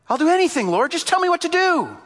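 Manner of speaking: high, loud, eager begging; desperate pleading. Acted. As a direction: beg at the top of your voice. Jack begs for instructions.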